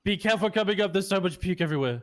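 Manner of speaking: loud call up the stairs. Real, not acted. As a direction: call a warning loudly. Warning EMS coming upstairs.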